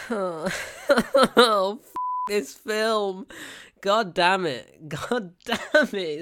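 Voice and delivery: slow, drawn-out, loud despair; exasperated groan. Real, not acted. Reviewer groaning at the awful film, slow and loud. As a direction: drag it out in laughing exasperation.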